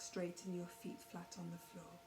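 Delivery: calm, even meditation voice with long pauses; guided meditation. Real, not acted. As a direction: ease them gently through each breath, unhurried. Leads a guided breathing meditation.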